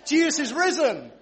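high, loud rallying call; exultant call-out; rousing proclamation. Real, not acted. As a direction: fire up the congregation to answer. Cueing congregation to cheer again.

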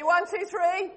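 ordinary talk, nothing marked

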